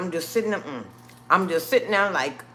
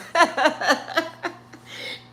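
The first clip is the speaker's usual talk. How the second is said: nervous laugh; 10 semitones higher than usual. Real, not acted. Nervous laugh at own story.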